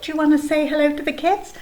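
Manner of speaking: usual speaking voice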